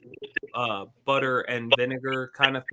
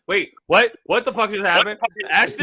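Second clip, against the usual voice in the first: louder, higher startled questions; mock baffled; 6 semitones higher, 6 dB louder than usual. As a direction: act stunned and confused. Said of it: "you'd be like".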